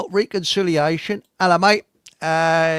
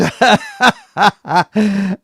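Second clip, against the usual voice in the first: loud, raised laugh between words; loud chuckling laugh; 4 semitones higher, 8 dB louder than usual. Real, not acted. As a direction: laugh heartily at your own joke. Host laughs at own remark.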